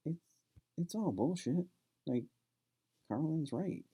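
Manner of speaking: breezy mock-parent shrug, voice up high; dismissive parents. Parents waving away son's objection.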